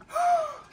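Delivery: sharp high gasp. Acted. Storyteller gasping at the news. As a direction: gasp as if shocked.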